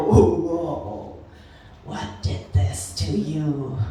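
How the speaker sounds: imitating concerned colleagues, low and drawn back; colleagues' reaction. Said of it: "and would go".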